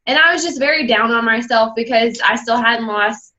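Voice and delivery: ordinary delivery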